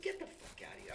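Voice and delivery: high, breathy disbelief; can't believe what he's hearing; stunned disbelief. Retold or imitated. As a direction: inmate told of a plot against him, shocked. Disbelief at kill order.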